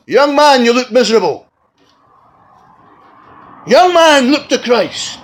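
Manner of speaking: high ringing call across the hall; preacher calling out. Retold or imitated. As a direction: call out to the young man, loud and high.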